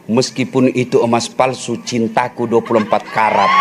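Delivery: low, soft and tender; romantic line. Retold or imitated. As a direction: murmur it like a love vow. Romantic punchline to wife.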